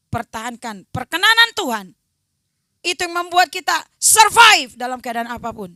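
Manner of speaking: loud preaching to the congregation; full-force preaching. Acted. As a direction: drive it home to the church.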